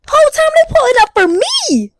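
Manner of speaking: high, loud comic reveal; punchline shouted high; shocked exclamation. Retold or imitated. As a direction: cry it out in mock dismay.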